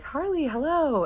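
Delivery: high, drawn-out hello; imitated gushing greeting; delighted greeting. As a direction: greet a dear guest in a high, stretched voice. Older couple greeting him delightedly.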